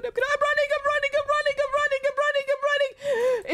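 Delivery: high, frantic repeated running; panicked fleeing. Real, not acted. Gamer flees the house, panicking as the monster nears. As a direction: blurt it out in real fright.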